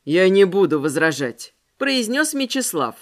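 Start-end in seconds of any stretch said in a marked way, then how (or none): 0.1-1.5 man's voice. Acted. Mechislav reply.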